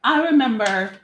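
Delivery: normal talking voice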